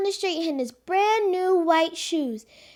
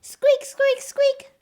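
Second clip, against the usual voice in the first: high squeaky shoe noise; squeaky shoe sound; 8 semitones higher, slower than usual. Shown as a demonstration. Girl imitates Pete's wet shoes squeaking. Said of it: "That's the sound his shoes made".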